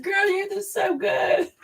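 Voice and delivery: proud cheer. Retold or imitated. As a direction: cheer the girl on like a proud auntie. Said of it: "I was like".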